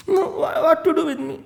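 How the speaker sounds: high, whiny sulk; sulky servant. Acted. Manthara sulks at Kekai, high whine. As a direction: sulk and refuse.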